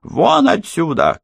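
high, drawn-out mock shout; woman's shout. A narrator's reading. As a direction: order the intruder out in a high woman's voice. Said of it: "крикнула женщина у неё за спиной". (высокий женский окрик)

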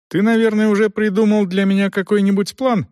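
high, careful, tentative asking; cautious question. A narrator's reading. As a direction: feel out the plan in a high, careful voice. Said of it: "осторожно спросил".

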